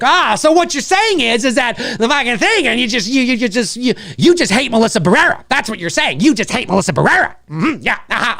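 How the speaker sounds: high, pushy mock accusation; mocking accuser. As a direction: play the bad-faith twister of words. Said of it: "it's like".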